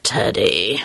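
low, soft warning; a hint of a tired dad's reproof; weary father. A narrator's reading. Sleepy father reproving child.

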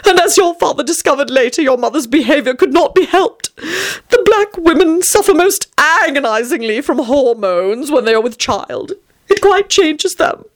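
high, dramatic fussy storyteller; fussy Tony. A narrator's reading. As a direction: play a prim, theatrical adult explaining to a child. Tony's speech in story narration.

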